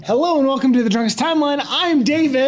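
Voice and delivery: quick, bright host read; fast eager intro. Real, not acted. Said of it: "You said drunk again".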